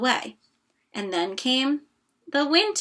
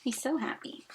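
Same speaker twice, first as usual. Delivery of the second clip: soft aside; 11 dB quieter than usual. Real, not acted. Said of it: "aside to the child".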